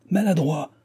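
high-pitched exclamation of reproach; angry cry. A narrator's reading. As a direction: cry out at a bungler. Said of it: "cria-t-il".

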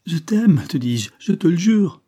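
high, loud, urgent oath; desperate insistence. A narrator's reading. Mistress swearing love to the man threatening her, desperately insistent. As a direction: swear it as if your life hangs on it.

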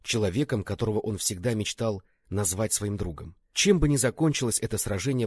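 ordinary delivery, nothing marked

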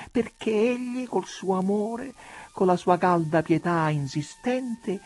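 lightly voiced prelate, halting then earnest; calm her down, then recount it earnestly; lightly acted coaxing churchman; placating monsignor. A narrator's reading. Reader voices the monsignor pleading, recounting.

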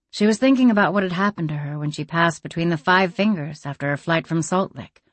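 plain reading voice

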